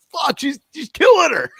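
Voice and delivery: sudden high yell of alarm; high-pitched shocked shout. Retold or imitated. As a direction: blurt it out in horror. Watching the brutal match beatdown.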